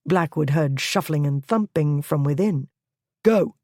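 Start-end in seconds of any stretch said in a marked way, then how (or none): 3.2-3.6 barked order. A narrator's reading. Blackwood ordering Pratt.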